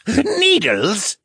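high, incredulous amused question; laughing. A narrator's reading. Captive merman echoes the captor's guess, laughing. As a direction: come out of the laugh pitched high. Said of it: "he laughed".

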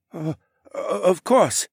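flustered and eager to please; nervous stammer. A narrator's reading. Shopkeeper giving way to the hero, stammering. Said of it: "he stammered".

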